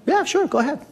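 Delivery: high-pitched mock executive voice; high, offhand quoted reply; executive's casual yes. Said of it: "And the guy goes".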